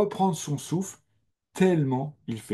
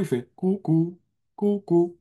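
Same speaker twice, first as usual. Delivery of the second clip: sung cuckoo call, rising higher; 5 semitones higher, slower than usual. Shown as a demonstration. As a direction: imitate the cuckoo's two notes. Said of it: "Donc, le coucou".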